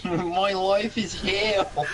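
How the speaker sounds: high, very slow, laughing; stretched-out high voice through laughter; stuck YouTuber voice. Pretend. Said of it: "you're gonna go".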